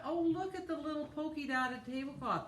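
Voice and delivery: high, bright delight at a find. Real, not acted. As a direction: coo over something cute. Delighted at new tablecloth.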